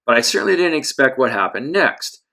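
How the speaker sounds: ordinary delivery, nothing marked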